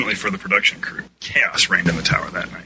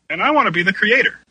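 high, louder eager demand; exclaiming; 13 semitones higher, 5 dB louder, faster than usual. A narrator's reading. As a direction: pipe up to claim a role. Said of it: "Kesset exclaimed".

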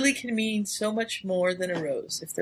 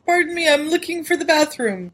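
innocent excuse; 8 semitones higher, 6 dB louder than usual. Retold or imitated. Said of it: "and he's like".

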